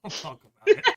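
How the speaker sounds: laughed through, high and loud; laughing. Real, not acted. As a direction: laugh it off mid-line. Joking after a rant.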